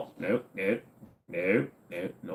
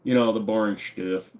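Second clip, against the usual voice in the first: normal talking voice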